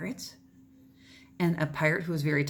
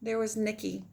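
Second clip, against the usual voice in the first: lively read-aloud; story narration. Acted. Teacher reading a picture book to her class, expressive narration. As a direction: carry the children through the tale.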